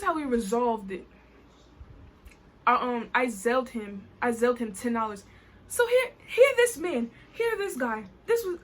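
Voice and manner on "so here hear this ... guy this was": high, loud, incredulous exasperation; exasperated outburst. Real, not acted. Storyteller asks viewers to hear this guy, exasperated. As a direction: burst out as if appealing to witnesses.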